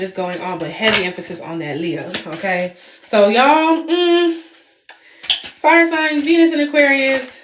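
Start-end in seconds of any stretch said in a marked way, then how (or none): 3.1-7.4 excited. Real, not acted. Excited about fire signs reading.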